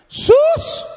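high, loud hog-calling holler; hog-calling shout. Retold or imitated. Imitating a woman yelling for her daughter-in-law, hog-calling shout. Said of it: "It sounded like a hog calling contest".

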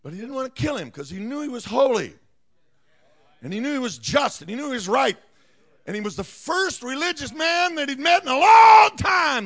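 loud, high, driving preacher cry; preaching at full force. Acted. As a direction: build it up and proclaim.

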